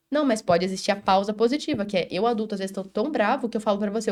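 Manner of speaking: usual speaking voice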